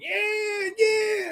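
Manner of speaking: high mock cheer of excited fans; cheering crowd. Fans cheering in the theater at the movie, high whooping cheer. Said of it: "everybody's going to the theaters going".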